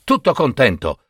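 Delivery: ordinary delivery, nothing marked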